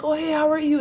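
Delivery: imitated high, loud hello; cheery greeting. Fellow passengers greeting her at the diner, mock-cheery hello. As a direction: mimic acquaintances calling out a bright greeting.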